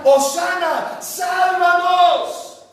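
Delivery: loud, high, drawn-out cry; crowd's cry. Retold or imitated. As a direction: call out like a pleading crowd. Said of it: "Y la gente empezó a gritar".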